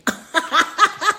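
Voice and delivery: high laugh. Real, not acted. Laughs at her old name.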